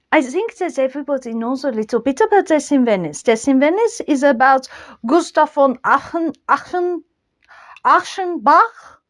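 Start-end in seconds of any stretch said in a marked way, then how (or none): 5.1-9.0 put-on stumbling over a German name through a French accent. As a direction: mangle Achenbach with French vowels, Aachen, Achenbach. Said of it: "try to German accent with French accent".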